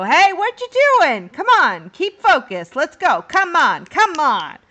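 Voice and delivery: loud, high urging shout at the snail; jockey yelling. Acted. Jockey shouting his snail onward mid-race. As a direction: yell at the snail to keep focus. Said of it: "one of the jockeys go".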